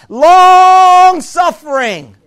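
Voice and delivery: "long" held very long and loud in "long-suffering"; drawn-out emphasis. Real, not acted. Preacher telling of God's patience, "long" hugely drawn out. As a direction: stretch "long" on one high note, then drop through "suffering".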